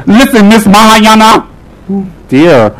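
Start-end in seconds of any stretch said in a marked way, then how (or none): 0.1-1.5 mock scolding. Talking back to scandalised aunties.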